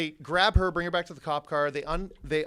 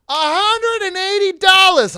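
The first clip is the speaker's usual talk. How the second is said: put-on much higher, louder and slower girly voice; piping up girl; 14 dB louder, slower than usual. Girl blurting her price to the cops, high and loud. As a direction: pipe up in a high, loud girl's voice. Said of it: "she pipes up out of nowhere".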